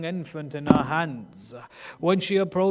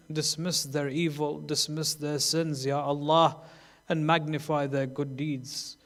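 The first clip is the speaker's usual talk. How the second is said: soft, lower supplication; closing prayer; 4 semitones lower, 6 dB quieter than usual. Acted. Preacher leading the congregation in prayer, soft and low. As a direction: pray quietly and humbly, winding down.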